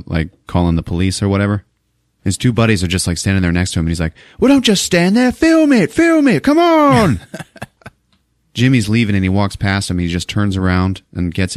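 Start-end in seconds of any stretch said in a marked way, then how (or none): 4.4-7.2 very high, loud British yelling; frantic mock-British shout at useless helpers; Seth MacFarlane. British executive ordering his buddies to film, shouted in a British accent. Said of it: "he's playing it British".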